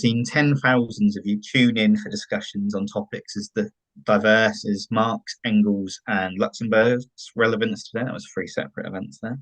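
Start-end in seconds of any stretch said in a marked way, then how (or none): none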